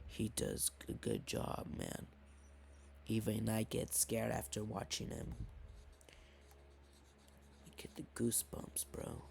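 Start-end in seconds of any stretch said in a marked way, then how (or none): 0.1-2.1 quiet. Real, not acted. Hushed aside about scary videos. 7.7-9.2 soft unvoiced whisper. Real, not acted. Whispers about getting scared.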